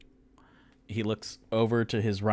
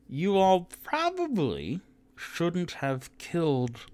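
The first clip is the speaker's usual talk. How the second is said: higher, slow, drawn-out mild scold; half-orc scolding; 10 semitones higher, slower than usual. Acted. Half-orc woman warns the boys, slow and drawn out. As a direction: stretch it out like a gentle warning.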